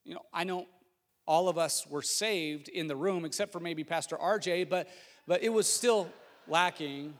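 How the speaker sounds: ordinary talk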